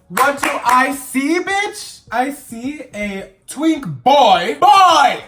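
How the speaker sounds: loud, slow, high disbelief; shocked outburst. Real, not acted. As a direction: drag it out loud like a shocked reveal. Shock at twink boy reveal.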